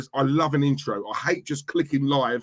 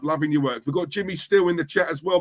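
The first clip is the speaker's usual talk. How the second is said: normal talking voice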